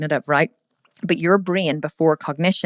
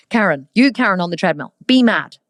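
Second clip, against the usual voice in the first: mock command; 3 dB louder than usual. Coach barking a mock order at Karen on the treadmill. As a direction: boss Karen around like a drill sergeant, tongue in cheek. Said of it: "So I'd be like".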